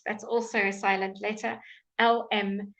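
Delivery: usual speaking voice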